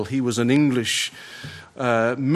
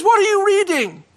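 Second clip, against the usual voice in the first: much higher, louder alarmed cry; desperate shout; 6 dB louder than usual. Retold or imitated. Prisoner seizing the chaplain, desperate shout. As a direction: grab him and demand it. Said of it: "he lurched forward and grabbed hold".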